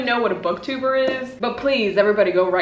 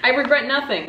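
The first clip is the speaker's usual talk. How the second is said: laughing; 5 semitones higher, faster than usual. Shown as a demonstration. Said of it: "Once again".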